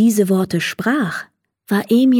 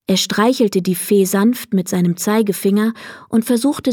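usual speaking voice

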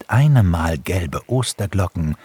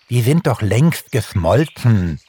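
lightly amused hare, higher voice; giggly; 5 semitones higher, 4 dB louder than usual. A narrator's reading. Hare teases that snow figures melted, lightly giggly. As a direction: say it with mild mirth. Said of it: "kicherte Hase Langbein".

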